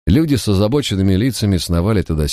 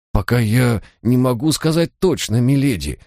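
higher-pitched, uncertain reply; flustered official; 7 semitones higher than usual. A narrator's reading. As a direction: answer a lady apologetically, at a loss. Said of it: "пробормотал чиновник, растерянно вертя в руках план". (растерянно)

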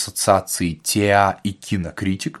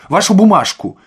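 loud, raised-pitch demand; impatient shout; 8 semitones higher, 7 dB louder than usual. A narrator's reading. Harried administrator demanding Ostap's paper, shouting. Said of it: "крикнул он Остапу".

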